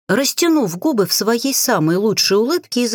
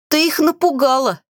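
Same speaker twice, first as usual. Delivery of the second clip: higher voice, lightly choked by amusement; wheezing with laughter; 7 semitones higher than usual. A narrator's reading. Samia teases her friend after the scare, wheezing. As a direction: wheeze it out, a touch of suppressed laughter. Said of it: "прохрипела Самия, икая от смеха".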